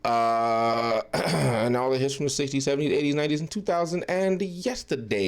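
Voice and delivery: loud, booming mock DJ station ident; playful fake radio host intro; radio announcer. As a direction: sell it like a radio jingle read.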